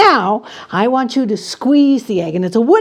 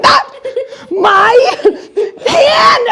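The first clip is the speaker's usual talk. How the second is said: each word split apart, high and loud; hammed-up wail; 13 semitones higher, 6 dB louder, much slower than usual. Performer wailing in mock pain at the volunteer. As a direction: stretch the cry out, word by word.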